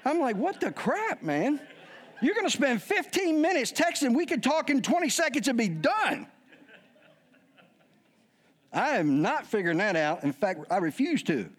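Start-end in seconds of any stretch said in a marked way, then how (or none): 0.4-6.3 high-pitched comic exasperation; exasperated rant. Retold or imitated. Dad ranting at his kids about texting, exasperated.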